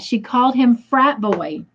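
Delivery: usual speaking voice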